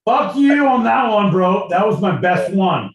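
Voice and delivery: loud, high-pitched outburst; indignant protest. Real, not acted. Podcaster told his Scottish accent was terrible, indignant protest. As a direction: protest loudly, pitched right up.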